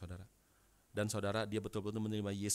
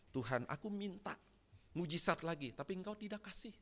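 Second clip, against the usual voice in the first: higher-pitched, acted plaint to God; disappointed complaint; 8 semitones higher than usual. Father complaining to God about a withheld miracle, acted disappointment. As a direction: play a let-down man pleading upward. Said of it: "Kecewa, saudara".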